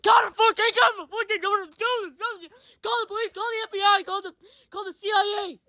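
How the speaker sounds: high, loud, fast frantic babble; frantic ranting yell. Acted. Ranting at Ben to call the police, high frantic yell. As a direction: spit it out in a mock panic.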